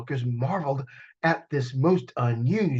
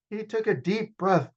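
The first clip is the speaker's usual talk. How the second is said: ordinary delivery, nothing marked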